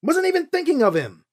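mad. A narrator's reading. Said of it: "She went from fuming to mad".

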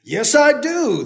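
slow, high, loud. Acted. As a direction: draw it out. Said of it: "the man said".

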